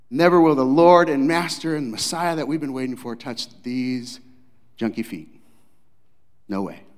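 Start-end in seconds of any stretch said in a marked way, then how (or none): none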